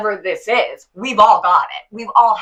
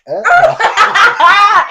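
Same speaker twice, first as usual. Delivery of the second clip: big high burst of laughter; loud delighted laugh; 12 semitones higher, 9 dB louder than usual. Real, not acted. Laughing at her friend's mirror speech, loud and high. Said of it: "He believes it too".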